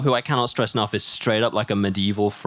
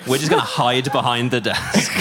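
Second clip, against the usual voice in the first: normal talking voice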